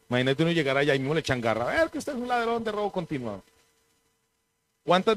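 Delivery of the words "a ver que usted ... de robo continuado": high, soft mock accusation; mock arresting officer. Someone grabbing a thief in eternity, mock accusing. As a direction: play an accuser in a raised, quiet pitch. Imagined arrest at heaven's gate.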